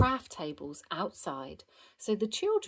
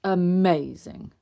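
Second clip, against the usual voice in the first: loud, drawn-out praise; big fatherly approval; admiring; 8 dB louder, slower than usual. A narrator's reading. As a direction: stretch the word with impressed delight. Father admiring child's costume.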